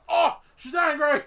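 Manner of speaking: loud, high, excited Australian cries; mock alarm in a put-on Australian accent. Host warns of angry producer, alarmed Australian accent. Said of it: "Can you talk with an Australian accent?".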